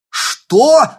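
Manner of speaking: shocked, very high loud yell. Acted. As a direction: scream it in disbelief. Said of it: "завопил Артём".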